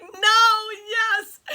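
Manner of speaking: high, loud squeal of disbelief then joy; excited shriek. Retold or imitated. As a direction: shriek it like the best news ever. Screaming back and forth over invite.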